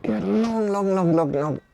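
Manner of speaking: slow, guiding stretch instructions; calm yoga teacher cue. Acted. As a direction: coax the class into a long stretch. Guides a stretch in yoga class.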